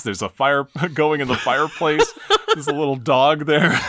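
laughing through the words. Real, not acted. Describing Guile's cosy household scene, breaking into laughter. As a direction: describe it while cracking up.

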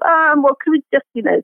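high-pitched, tentative request, retold; mock flustered request, starting high; hesitant polite plea. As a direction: act out a sheepish learner asking for mercy. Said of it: "You go".

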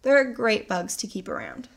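ordinary talk

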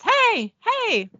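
high-pitched calling out; a contained mock shout for attention; acted shout. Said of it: "and Dean shouts".

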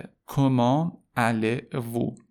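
comment allez-vous very slow, syllable by syllable; slow teaching model. Shown as a demonstration. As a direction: draw out each syllable for learners.

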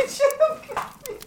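very high squeaky mimic, laughing through it; squeaky laughing echo. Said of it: "Too good".

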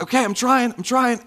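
strained mock struggle, pitched high; struggling volunteer. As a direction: imitate someone straining to get free. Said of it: "So they're struggling".

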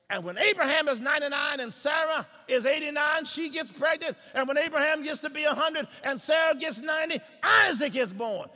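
loud, high, driving pulpit delivery; fervent preacher pressing the congregation; full-force preaching. Real, not acted. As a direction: build to a shouted exhortation.

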